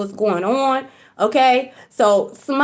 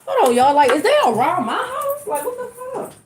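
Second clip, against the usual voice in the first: startled; 9 semitones higher than usual. Real, not acted. Startled by car speaker outside.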